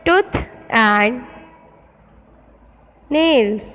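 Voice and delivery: each pair spoken slowly with long pauses, like dictating to a class; slow dictation reading. Acted. Teacher dictating word pairs to her class, slow and deliberate.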